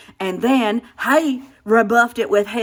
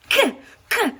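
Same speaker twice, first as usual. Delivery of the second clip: high, choked kuh sounds; sputter, words stuck in the throat; strangled sputter; 10 semitones higher than usual. Acted. Wife choking back a cutting reply to husband.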